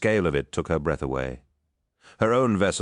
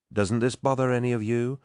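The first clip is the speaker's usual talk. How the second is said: higher-pitched, incredulous question; agitated outburst; 8 semitones higher than usual. A narrator's reading. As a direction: let the held-back worry slip out, pitched up. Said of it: "unable to contain himself any longer".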